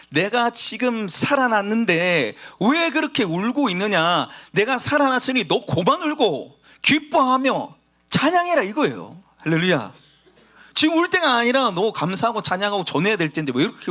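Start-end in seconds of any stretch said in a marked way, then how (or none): 0.1-8.6 louder, higher, urging call to stop weeping; risen Jesus urging joy. Acted. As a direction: press her to rejoice instead of weeping. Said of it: "질문한 게 아니고". (기뻐하라고 재촉하는 예수님의 높고 큰 목소리)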